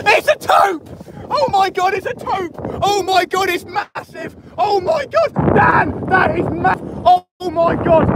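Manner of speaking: high, breathless disbelief at a giant catch; gushing over the fish to Dan; real excitement. Real, not acted. Angler shouting to his mate Dan about the huge fish, thrilled.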